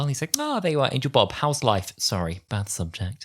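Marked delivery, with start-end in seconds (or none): 0.4-1.9 higher, breezy mock greeting; sassy Doctor. The Doctor sassing Angel Bob, higher and cheeky. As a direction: toss it off with cheeky sass. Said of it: "the Doctor starts sassing Angel Bob".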